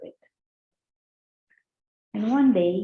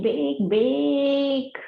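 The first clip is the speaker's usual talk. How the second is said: big, big pitched way up and stretched; stress on big; 8 semitones higher, 4 dB louder than usual. Acted. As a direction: push each big up high and long. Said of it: "Ocean, that is big".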